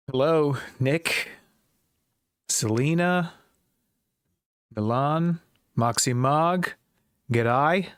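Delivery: a light, hammed-up Australian accent on "G'day" with a rising end; mock Australian accent. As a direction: toss out "G'day" like a playful fake Australian. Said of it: "horribly offensive Australian accent".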